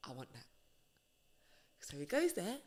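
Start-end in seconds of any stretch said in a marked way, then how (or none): 0.1-0.5 whispered desire, very soft; hushed wanting. Retold or imitated. David spying on the bathing wife, soft whisper. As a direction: murmur it like a secret craving. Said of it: "he's like".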